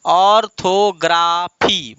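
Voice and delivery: "Orthography" slowly, each of ऑर, थो, ग्रा, फी set apart; syllable by syllable. Shown as a demonstration. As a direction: draw out "orthography" in four even pieces. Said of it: "तो एक, दो, तीन, चार". ("Orthography" धीरे, हर खंड अलग)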